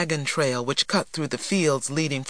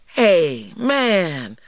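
loud, easygoing protest; exclaiming; 4 semitones higher, 5 dB louder than usual. A narrator's reading. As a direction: call it out, grinning. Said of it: "T J exclaimed".

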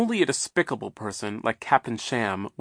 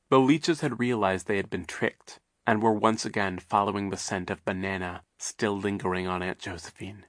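ordinary delivery, nothing marked

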